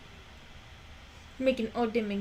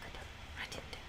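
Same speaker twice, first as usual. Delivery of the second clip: soft unvoiced whisper; 17 dB quieter, much faster than usual. Real, not acted. Whispering to the dog to look at the camera. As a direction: breathe it, no voice.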